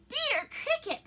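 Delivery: very high, loud, delighted greeting; happy cry. A narrator's reading. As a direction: cry out joyfully to an old friend. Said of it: "Pinocchio cried happily".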